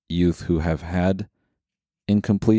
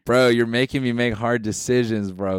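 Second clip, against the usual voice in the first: pleading bro Jesus, a bit higher; frat bro; 4 semitones higher than usual. Pretend. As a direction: beg him like a buddy. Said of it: "Jesus is like".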